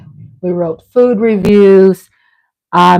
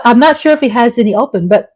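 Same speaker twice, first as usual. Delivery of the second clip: ordinary talk, nothing marked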